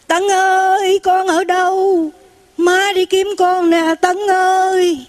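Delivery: high, long drawn-out wail of a mother; imitated heartrending call. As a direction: call out for your lost child, stretching every word. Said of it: "cái tiếng người mẹ kiếm con rất là thảm thiết".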